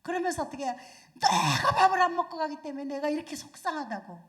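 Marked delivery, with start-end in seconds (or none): 1.1-4.2 loud, aggrieved complaint; mother scolding, louder; upset mother. Retold or imitated. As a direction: vent hurt at the child.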